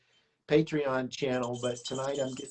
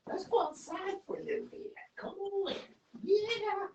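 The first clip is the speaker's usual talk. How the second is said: pet-talk voice; 3 dB quieter than usual. Real, not acted. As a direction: coax the cat out, high and sweet. Coaxing cat outside.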